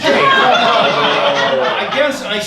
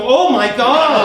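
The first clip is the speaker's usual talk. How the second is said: alarmed; 4 semitones higher, 4 dB louder, slower than usual. Retold or imitated. Realizes he's on fire.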